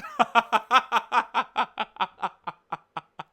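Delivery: long laugh that trails away; hearty laugh. Real, not acted. Joking that viewers will hate the list, hearty laugh. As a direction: laugh it out, gradually dying down.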